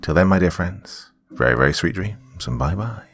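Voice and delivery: ordinary delivery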